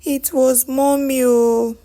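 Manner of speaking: high, loud wail of confession; tearful cry. A narrator's reading. Tolani confessing to her brother, crying. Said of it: "she started crying, saying".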